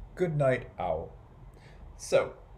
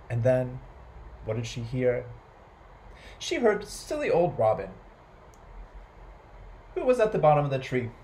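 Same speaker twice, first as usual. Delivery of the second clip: usual speaking voice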